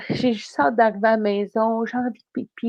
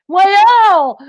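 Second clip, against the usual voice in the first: high, loud "voyons" as if shocked; feigning innocence to the driver, hammed-up; overplayed innocence; 9 semitones higher, 8 dB louder than usual. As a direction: act wide-eyed innocent, pitched way up. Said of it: "j'essaie de me tordre les doigts pour faire".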